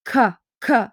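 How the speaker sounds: "k, k" as two separate hard bursts; hard k sound. Shown as a demonstration. Teacher giving an example of a hard consonant sound, isolated k. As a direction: sound out "k, k" crisply, each on its own. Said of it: "that's like a hard consonant sound".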